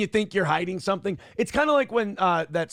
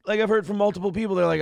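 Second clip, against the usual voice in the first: ordinary talk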